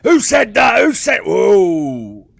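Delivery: high, loud shout dragging into a startled whoa; angry guard shouting. Acted. Guard running back at the scream, high startled shout. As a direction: burst in yelling, then pull up short. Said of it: "the guard comes running back to Nun and goes".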